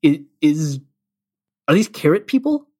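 acted-out confusion with stop-start questions; puzzled wondering. Co-host voicing a listener puzzling over the lyrics, mock bewilderment. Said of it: "you're wondering".

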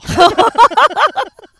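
high, loud burst of laughter. Real, not acted. Laughing at the Carlow pick, high loud laughter.

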